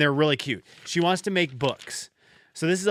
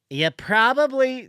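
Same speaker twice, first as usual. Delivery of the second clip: drawn-out, higher-pitched mock know-it-all; dumb-me impersonation; 10 semitones higher, 4 dB louder, slower than usual. Coworker lecturing a grown man, self-mocking dumb voice. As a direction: put on a higher, slow voice mocking yourself. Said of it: "impersonation of me being dumb".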